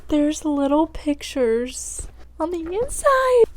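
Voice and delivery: high, slow, breathless awe; excited gasp. Real, not acted. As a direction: marvel at it, drawing out every word. Gasping at the book's inner pictures.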